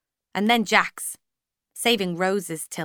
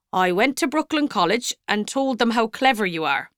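cheerful priest; 3 semitones higher, 3 dB louder than usual. A narrator's reading. Priest telling Ailish about the college, cheerful. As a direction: share the news proudly. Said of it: "Father Flood said cheerfully".

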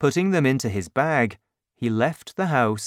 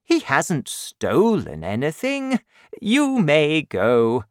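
assured bishop; 4 semitones higher, 3 dB louder than usual. Acted. Bishop dismisses the policemen.

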